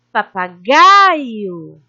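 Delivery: papagaio very slowly, with a loud, long gai; lengthened stressed syllable. Shown as a demonstration. Teacher showing the stronger syllable takes longer, drawing out gai in papagaio. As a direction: swell and hold gai in papagaio. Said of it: "a sílaba mais forte a gente se demora".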